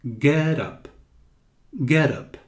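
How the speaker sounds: "Get up" with a quick d-like flap for the t; American flap in "Get up"; flapped t. Shown as a demonstration. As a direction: tap the t lightly like a fast d. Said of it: "um D muito rápido".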